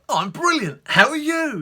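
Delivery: very high, loud, gushing reply; frenzied cheer. Retold or imitated. Answering the courier, in gushing cheer. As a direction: outdo his enthusiasm. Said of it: "I was like".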